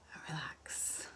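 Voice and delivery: hushed, breathy release; relaxing cue. Shown as a demonstration. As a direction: let the word sink into a near whisper. Leading relaxation exercise.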